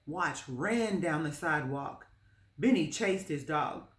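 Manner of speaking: plain reading voice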